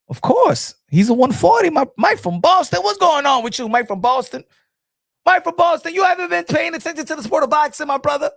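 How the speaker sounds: high, loud, exasperated correction; loud teasing rebuke. Real, not acted. Host scolds Mike from Boston for not paying attention, loud teasing. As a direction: call out a friend, voice pitched right up. Scolding a viewer's mistaken comment.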